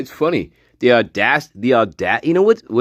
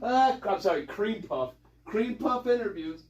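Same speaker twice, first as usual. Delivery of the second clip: softly laughing correction; 6 semitones higher, 12 dB quieter than usual. Real, not acted. Corrects himself, still laughing.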